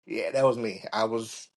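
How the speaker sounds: ordinary talk, nothing marked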